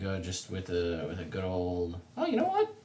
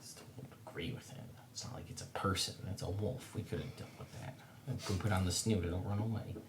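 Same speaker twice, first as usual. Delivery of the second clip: muttering; 9 dB quieter than usual. Acted. Player's character grumbles walking over to the plant, soft mutter. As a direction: grumble under the breath, very soft. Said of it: "I mutter all of these things out loud".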